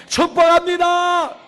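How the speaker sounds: high, loud, drawn-out blessing; full-force preacher's cry; shouted blessing. Real, not acted. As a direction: proclaim it to the whole congregation.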